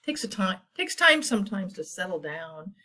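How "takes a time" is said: ordinary delivery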